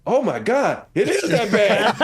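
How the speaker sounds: high, loud, slow shocked gasp; shocked wife. Retold or imitated. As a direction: let it dawn on her, loud. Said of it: "She said".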